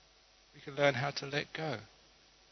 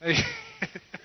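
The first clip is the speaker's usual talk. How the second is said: real laugh. Real, not acted. Teller laughs at his own story.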